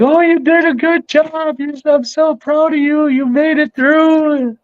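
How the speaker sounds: high, gushing impression of a proud fan; imitating the fan. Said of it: "she was like".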